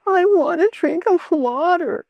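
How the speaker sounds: high, nasal whining plea; whiny child. Shown as a demonstration. Mother imitating her child whining for water. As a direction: whine it like a spoiled child. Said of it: "if the child comes up to you and says".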